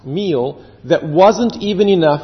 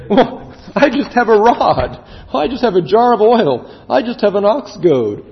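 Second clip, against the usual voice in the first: higher-pitched, put-on protest; mock dismissive reply; 6 semitones higher than usual. Listeners laughing at the preacher's question, mock dismissive. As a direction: toss out each item as a useless little thing. Mimics scoffing listeners answering God.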